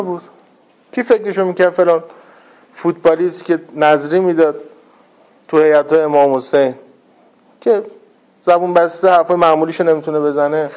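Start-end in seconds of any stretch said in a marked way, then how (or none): none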